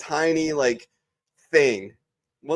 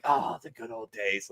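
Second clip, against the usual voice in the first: mock nostalgia; 9 dB quieter than usual. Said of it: "we still look back at it like".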